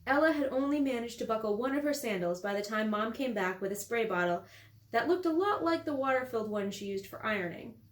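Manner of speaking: ordinary delivery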